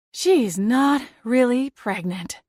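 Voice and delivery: slow, dazed disbelief; stunned. Acted. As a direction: say it in shock, slowly. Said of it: "still stunned by the revelation".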